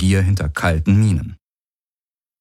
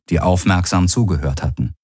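usual speaking voice

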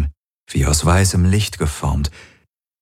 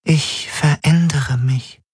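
higher, fragile spirit voice, half whispered; a changing spirit confessing fear and relief; frightened glass spirit; 7 semitones higher than usual. A narrator's reading.

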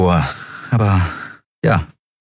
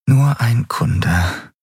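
slow mantra; 4 semitones higher, much slower than usual. Acted. Repeated inner mantra.

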